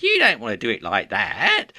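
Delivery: mimicking a nitpicker in a little nasal whine; nasally comic fault-finder; irritating nasal voice. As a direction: put on an irritating, nasally know-it-all voice. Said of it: "this sort of irritating little nasally voice".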